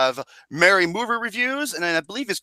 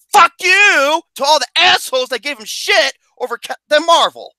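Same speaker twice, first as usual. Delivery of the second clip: loud, high-pitched cursing; indignant shout; 8 semitones higher, 6 dB louder than usual. Real, not acted. Host to those who gave a player grief, indignant shout. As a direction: shout down the critics on a friend's behalf.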